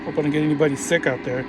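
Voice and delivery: ordinary talk, nothing marked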